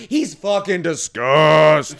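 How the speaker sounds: loud, high, disgusted tirade; outraged comic rant, words stretched out. Real, not acted. Comic outraged rant about bandmate.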